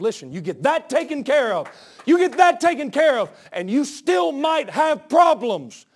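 loud, rising preacher's call; preaching at full force. Real, not acted. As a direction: drive the point home loudly. Preaching climbs again.

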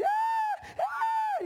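very high imitated scream of a panicking kid; mock child's cry. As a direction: squeal like a child struggling in water. Imitating drowning kid's cries.

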